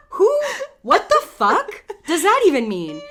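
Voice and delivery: loud, high-pitched mock outrage; pissed off. Retelling her reaction to the stranger, loudly pissed off. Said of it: "So I'm pissed the fuck off".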